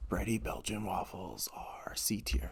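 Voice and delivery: slow dramatic whisper; hushed confession. Acted. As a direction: whisper it like a scandalous verdict. Confessing a daring low ranking.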